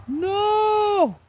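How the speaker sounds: very high, loud No; real shout. Real, not acted. Angler cries out at the lost catfish. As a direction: wail it out in despair.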